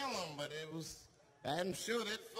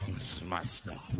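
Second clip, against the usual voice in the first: lightly voiced low homeless man; 13 semitones lower than usual. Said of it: "He came back to his senses".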